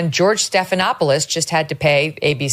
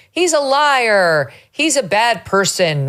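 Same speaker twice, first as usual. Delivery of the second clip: mock accuser; 6 semitones higher, slower than usual. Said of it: "take barbs like".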